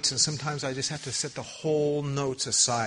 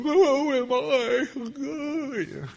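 high, long-drawn mock wail; hammed-up prophet crying out undone; Isaiah; 8 dB louder, much slower than usual. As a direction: stretch it into a loud lament. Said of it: "he's going".

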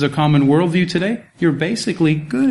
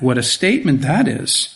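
usual speaking voice

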